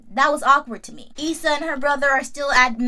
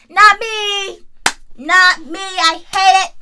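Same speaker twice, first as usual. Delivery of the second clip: loud, higher, emphatic no thanks; emphatic refusal; 8 semitones higher, 10 dB louder, slower than usual. Real, not acted. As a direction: belt out the refusal. Ranting about awkward family visits.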